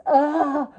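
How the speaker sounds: loud, high guttural "ugh"; imitated cry-scream. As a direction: act out a sudden release from the gut. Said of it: "just this".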